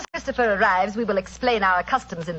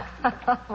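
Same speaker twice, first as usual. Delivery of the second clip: gloating laugh; 3 semitones higher, 4 dB quieter than usual. Acted. Villain gloats over trapped Holmes.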